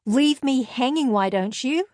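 loud, indignant phone yell. A narrator's reading. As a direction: yell accusingly at the friend who left you waiting. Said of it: "Hadley yelled in my ear".

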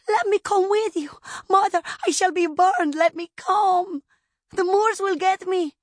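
put-on high, loud child's plea; panicked child pleading. A narrator's reading. Child begging mother to take her, panicked and loud. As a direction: beg desperately as a little girl.

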